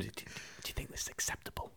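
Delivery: hushed, voiceless mock scream; retold scream. Teacher confronting pupils over the drawing, hushed mock scream. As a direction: imitate an outraged teacher under your breath. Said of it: "he just screamed".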